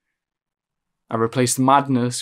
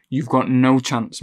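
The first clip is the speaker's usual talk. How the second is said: normal talking voice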